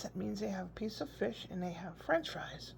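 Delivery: hushed whisper; mock whispered aside; whispered explanation. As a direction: whisper it to a small child. Explaining to grandson.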